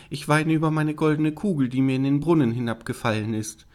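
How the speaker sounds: lamenting princess. Acted. Princess telling the frog why she cries, plaintive. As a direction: play a princess explaining her loss.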